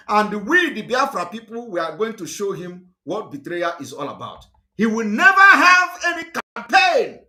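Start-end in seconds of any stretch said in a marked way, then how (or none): 4.8-7.2 high, loud shouted vow; angry shout. Real, not acted. Speaker vowing to block Obi's campaign, angry shout. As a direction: shout it as a defiant declaration.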